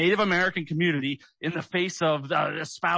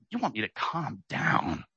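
ordinary delivery

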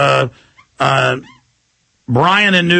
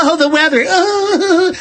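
much higher, bubbly mock morning anchor; mock giggly anchor; 13 semitones higher, slower than usual. Host mocks morning show anchors, gushing high and giddy. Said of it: "they giggle at the start of shows".

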